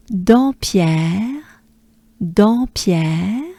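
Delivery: Dampierre with nasal first vowel, rising end; French pronunciation. Shown as a demonstration. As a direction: model French "Dampierre" for learners. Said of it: "same sound as Dampierre".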